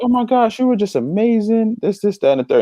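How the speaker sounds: high, gushing mock praise. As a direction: gush over him in a high voice. People praising the boy excitedly.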